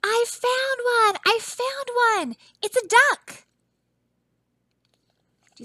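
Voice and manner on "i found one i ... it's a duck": high, loud shout of discovery; excited shouting. Acted. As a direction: call it out like a kid who found treasure. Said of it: "Someone is shouting".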